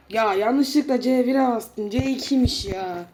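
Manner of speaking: loud slow frustrated complaint; annoyed groan. Real, not acted. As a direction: grumble at your mistake. Annoyed at own mistake. (sinirli, yavaş yakınma)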